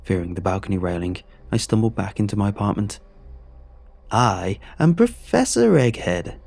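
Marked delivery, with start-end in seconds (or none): 4.1-6.4 high, slow booming egg monster voice; booming Egghead. Acted. A monstrous egg host booming his name at the screen. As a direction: boom it out like a deranged game show villain. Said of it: "boomed the television".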